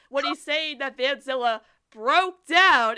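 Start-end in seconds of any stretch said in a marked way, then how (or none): 1.9-3.0 "broke down" slow and louder, set apart; heavy, sceptical emphasis on "broke down"; audible air quotes. Retold or imitated. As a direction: stretch "broke down" as if in quotation marks. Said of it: "in quotation marks".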